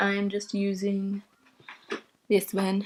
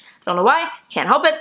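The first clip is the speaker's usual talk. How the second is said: normal talking voice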